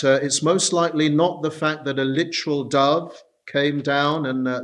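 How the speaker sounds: ordinary talk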